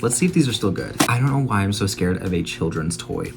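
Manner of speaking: normal talking voice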